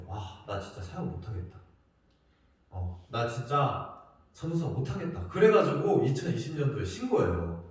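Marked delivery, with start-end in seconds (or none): 0.1-5.3 soft, low, defeated sigh; despairing. Retold or imitated. Preacher telling himself he can't go on, defeated and quiet. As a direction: give up quietly, worn out. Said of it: "전사님이 그랬어".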